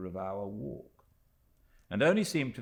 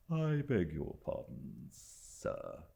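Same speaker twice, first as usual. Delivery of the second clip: unhurried, superior manservant; slow condescending valet; slower than usual. A narrator's reading. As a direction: talk down to the young guest slowly. Littimer announces the visitor.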